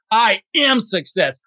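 "am" drawn out and punched; slow affirmation, hit the word "am"; stress on AM. Shown as a demonstration. Coach demonstrating an affirmation, stress on "am". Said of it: "you emphasize different words".

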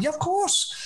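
high, eager mock reply; eager yes. Agreeing to come to the show, high eager yes. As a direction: squeak out a keen yes.

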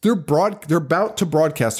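usual speaking voice

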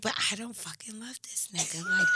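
unvoiced whisper of a secret thought; whispered inner thought. He says I love you and I whisper to myself. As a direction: hiss it under your breath, nobody may hear.

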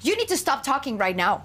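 mildly curt imitation of a rude stranger; impertinent interruption. Imitating rude man interrupting a video call, impertinent. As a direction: cut in rudely, understated. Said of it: "de una manera muy, este, impertinente".

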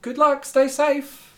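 high, slow friendly farewell; high slow cheerful goodbye; guard's farewell. Acted. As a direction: send them off kindly and slowly. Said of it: "She's like".

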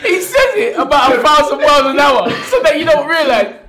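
loud, high, laughing, almost shouting; howling with laughter mid-sentence; laughing loudly. Real, not acted. Manager laughing about how fast Steve says Chelyabinsk. Cracking up mocking Steve Bunce.

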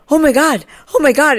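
high, loud flustered "oh my God"; imitating a startled woman bursting in; shocked gasp. Stranger walking in on a mammogram, shrieking in shock. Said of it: "and she's like".

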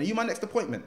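lightly voiced probation officer, high; high woman's voice. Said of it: "She went".

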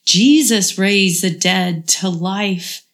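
ordinary delivery

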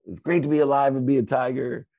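high, loud rally cry reenacted; retold team rallying yell. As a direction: yell it like a team captain firing up exhausted players. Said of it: "And I'd scream".

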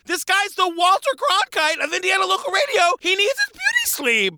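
high-pitched, playful indignation; mock outrage. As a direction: protest on a sleepy newsman's behalf, hammed up. Mock outrage defending sleeping Fred.

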